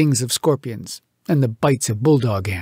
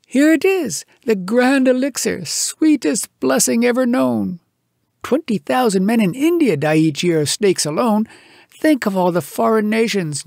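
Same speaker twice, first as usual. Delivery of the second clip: much higher, eager, grand voice; raised, rapturous bragging; exultant boasting; 6 semitones higher than usual. Acted. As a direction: dream aloud of fame. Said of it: "a cheer of exultation burst aloud".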